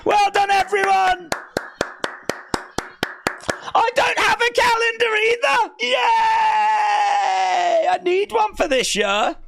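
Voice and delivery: high-pitched jubilant shouting; excited cheering. Real, not acted. Cheering the announced Sunday date.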